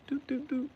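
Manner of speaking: high-pitched dialing doots; high phone beeps. Retold or imitated. Narrator mimics a flip phone dialing, high beeps. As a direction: imitate phone keys in a high tone.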